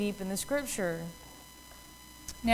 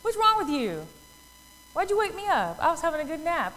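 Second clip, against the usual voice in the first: higher-pitched, mildly exasperated retelling; mock indignation, hand on hip; hand-on-hip annoyance; 5 semitones higher than usual. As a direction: lightly play someone grumpy at being woken. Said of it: "Jesus with his hand on his hip".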